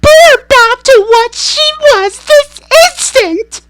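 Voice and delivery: very high, loud, slow command; mother demanding Wonka fix her; furious demand. A narrator's reading. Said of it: "yelled Missus Beauregard".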